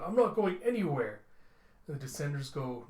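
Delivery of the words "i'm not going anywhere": louder, higher defiant line; robot boy refusing to be taken; defiant refusal. Acted. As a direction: plant your feet and refuse. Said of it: "he's going".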